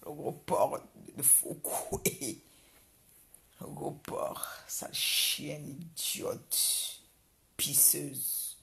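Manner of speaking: slow, breathy whispered insults; slow taunting whisper; hissed whisper. Real, not acted. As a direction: whisper the insults slowly and spitefully.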